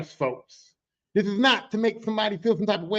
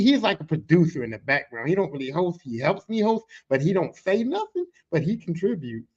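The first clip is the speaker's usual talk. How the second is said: ordinary delivery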